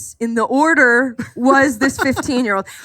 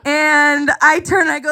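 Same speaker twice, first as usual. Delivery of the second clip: ordinary talk, nothing marked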